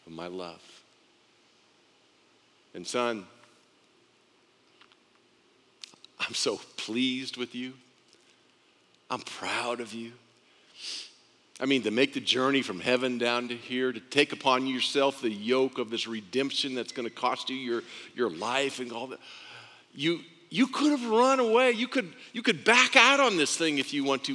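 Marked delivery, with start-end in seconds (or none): 0.1-10.1 hushed warmth of a proud father; tender fatherly pride. Acted. Father affirms his Son. 19.9-24.3 high-pitched, earnest marvelling; fatherly awe at a son's choice; impassioned admiration. Acted.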